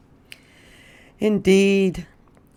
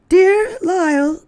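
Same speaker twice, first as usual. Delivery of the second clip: much higher lady's voice; Missus Prim reading; 6 dB louder than usual. Acted. Missus Prim reading the second note aloud. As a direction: read it out in a fretful lady's voice. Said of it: "read Missus Prim".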